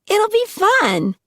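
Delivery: put-on very high, slow drunken squeal. Tina inviting Angus upstairs, very high squeal. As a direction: squeal it like an excited party girl.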